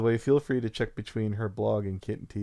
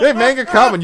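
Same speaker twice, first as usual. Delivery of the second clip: loud, high laughing shout; laughing call-out; 13 semitones higher, 14 dB louder than usual. Real, not acted.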